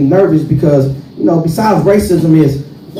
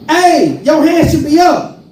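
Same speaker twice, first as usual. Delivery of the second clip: shouted stick-up order, higher; mock robber shout; 10 semitones higher, slower than usual. Robber shouting at him as he gets out of the car. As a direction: bark it like a street robber.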